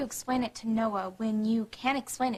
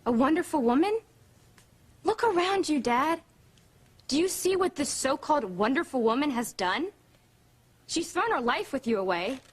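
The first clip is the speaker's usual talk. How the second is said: raised, indignant sarcasm; scornful; 4 semitones higher, 3 dB louder than usual. Acted. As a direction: throw the praise back at him in disbelief. Daughter throws father's praise back.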